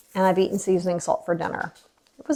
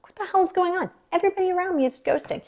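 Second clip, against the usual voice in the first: high-pitched, incredulous retold complaint; bewildered complaint; 10 semitones higher, 4 dB quieter, faster than usual. Client feeling ghosted by everyone, high bewildered complaint. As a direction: squeak out the disbelief.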